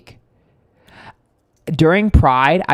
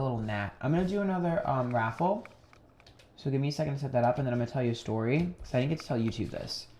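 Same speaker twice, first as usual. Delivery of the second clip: much softer and fast, talking half to herself; hushed quick aside; 13 dB quieter, faster than usual. Real, not acted. Telling the viewers to wait while she sets up, hushed and hurried. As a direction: murmur it quickly while setting up the raffle. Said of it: "quiet aside to the chat".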